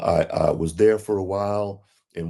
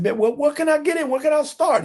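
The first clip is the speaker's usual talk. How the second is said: much higher, pleading voice; eager begging; faster than usual. Retold or imitated. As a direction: beg to be let in, wound up high. Narrator retells eager plea.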